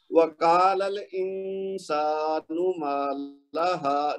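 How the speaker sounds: الْإِنسَانُ with the n hidden and held two beats; slow recitation, lingering on the n of الْإِنسَانُ; ikhfa demonstration. Shown as a demonstration. Teacher demonstrates ikhfa, a held hidden n in الْإِنسَانُ. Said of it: "تو اخفا کیسے ہوگا؟".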